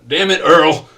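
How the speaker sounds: loud, high angry yell at the officer; arguing angry father. Acted. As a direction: shout it like a furious dad. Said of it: "the couples are arguing with him".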